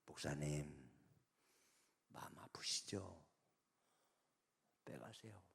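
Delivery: hushed, resigned answer to the caller; whispered reply. Retold or imitated. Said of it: "그래서 내가".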